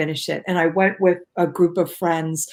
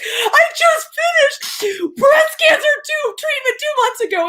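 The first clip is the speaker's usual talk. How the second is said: retold weeping yell, pitched way up; tearful shout. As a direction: call out to the crowd through happy tears. Screams finish news to crowd.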